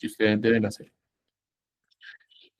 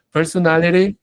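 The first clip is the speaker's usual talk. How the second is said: "personality" with an American flapped t; American "personality", ending tapped as "-lery"; more North American; 5 semitones higher, 4 dB louder than usual. Shown as a demonstration. Teacher contrasts two accents for students, more North American. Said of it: "estoy sonando más norteamericano".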